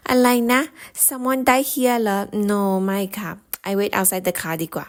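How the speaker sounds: put-on Thai auntie refusing, thick Thai accent. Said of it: "Thai aunties ... they'll be like".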